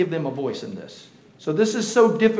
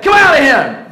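loud, raised authoritative order; stern command; 9 semitones higher, 12 dB louder than usual. Acted. Jesus casting out the spirit, loud stern order. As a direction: cast the spirit out with force. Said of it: "Jesus speaks sternly".

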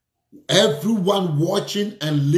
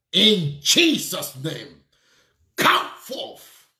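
commanding deliverance prayer; 3 semitones higher than usual. Real, not acted. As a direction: call them out with full authority. Preacher commands deliverance over listeners.

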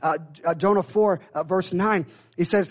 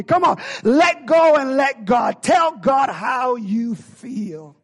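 slow, high, shouted appeal; preacher urging the congregation, slow and loud; exhortation; 4 semitones higher, 4 dB louder, much slower than usual. Real, not acted. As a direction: call the church to let go. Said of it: "preacher exhorting church".

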